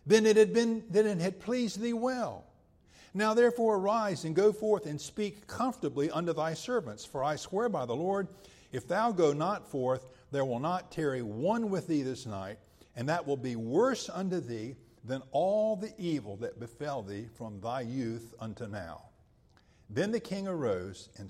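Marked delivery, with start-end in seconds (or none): 0.1-7.0 higher and louder, hammering each phrase; forceful rebuke. Acted. Peak of Joab's rebuke. 7.0-19.0 rising to a forceful rebuke, louder and higher; scripture reading, preaching force. Acted. Reads Joab's rebuke to David.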